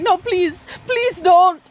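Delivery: hammed-up plea; mock pleading. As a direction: imitate a scammer begging for mercy. Imitates scammers begging.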